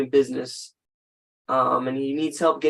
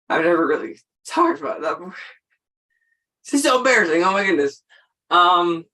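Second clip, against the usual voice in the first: tearful, embarrassed; 3 semitones higher, 5 dB louder, faster than usual. Real, not acted. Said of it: "I don't know why I'm getting emotional".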